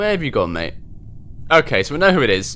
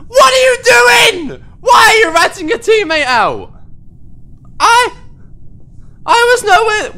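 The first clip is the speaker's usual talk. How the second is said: much higher, louder, near-crying yell; real outraged wail at teammate; outraged shout; 5 dB louder than usual. Real, not acted. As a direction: scream in disbelief at the betrayal. Teammate calls him out.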